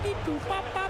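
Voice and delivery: very high, beeping robot sounds. Retold or imitated. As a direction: chirp it like a little robot. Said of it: "a robot out there".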